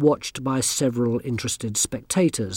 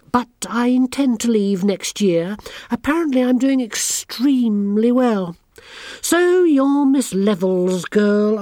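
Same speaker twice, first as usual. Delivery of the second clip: put-on high, smug and sneering girl; haughty schoolgirl; 8 semitones higher, 4 dB louder than usual. A narrator's reading. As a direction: look down your nose at everyone. Anagramma boasts and sneers.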